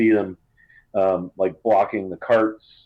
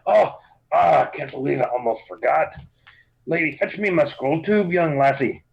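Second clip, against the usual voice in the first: sudden remembering; 5 semitones higher than usual. Acted. Dwarf remembers the letter suddenly.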